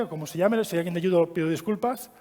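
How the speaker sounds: usual speaking voice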